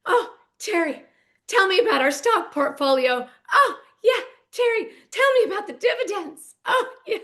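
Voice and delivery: high, hammed-up breathy moans; mimicking Heather moaning to Terry about money; mock moaning wife. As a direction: ham up a wife in bed, voice soaring. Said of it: "can you just see it".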